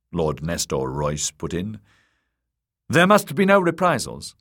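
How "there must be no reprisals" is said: high, loud, emphatic demand; insistent. A narrator's reading. Templeton setting terms for Littlefinger, insistent. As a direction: insist on it, pitched high and forceful. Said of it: "insisted Templeton".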